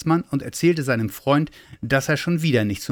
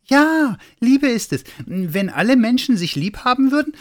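very high, loud, jubilant little Santa; elated high-pitched exclamation; excited discovery; 13 semitones higher, 5 dB louder than usual. Acted. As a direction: burst out with the realisation.

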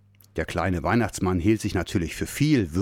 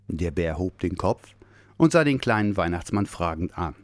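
usual speaking voice

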